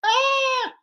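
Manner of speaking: very high, held "ah"; sudden falsetto wail, imitating a singer; imitated high cry. Retelling the singer on stage, high held cry. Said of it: "And then he goes".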